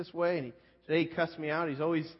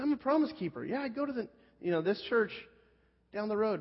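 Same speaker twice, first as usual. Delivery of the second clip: high, bright-eyed gushing coworker; eager Billy voice; 7 semitones higher than usual. Retold or imitated. Billy beaming at the new guy over his hat, high eager voice. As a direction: pitch it up like a proud, beaming church man. Billy boasting about church.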